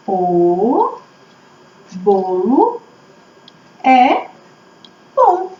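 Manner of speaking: word by word with long pauses; slow, spaced-out sentence building; dictating while writing. Shown as a demonstration. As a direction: stretch each word as if writing it down. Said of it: "pra gente escrever junto".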